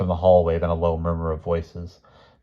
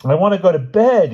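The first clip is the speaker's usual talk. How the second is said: put-on loud, high child's wail; high-pitched plaintive protest; 13 semitones higher, 9 dB louder than usual. A narrator's reading. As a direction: wail like an overtired little boy. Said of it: "he wailed".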